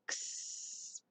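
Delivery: "X" said as a drawn-out "ks" sound; "X" as its phonics sound, a long hissed "kss"; letter sound. Shown as a demonstration. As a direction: sound out "X" as "ksss". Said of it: "Say X".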